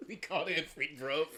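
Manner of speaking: laughing, hammed-up British correction; mock British. Friends riffing on British pronunciation, mock British. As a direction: say it like a cheeky Londoner, laughing. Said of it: "too much British".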